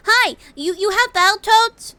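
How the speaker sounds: put-on high, loud girlish voice; cutesy prank caller. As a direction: squeak it like a shy anime girl. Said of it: "just be like".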